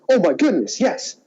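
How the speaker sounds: ordinary delivery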